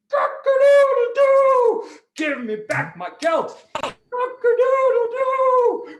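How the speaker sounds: high, loud crow with long drawn-out vowels; crowing rooster. Acted. Rooster to King Antiochus demanding its gelt, crowing loudly.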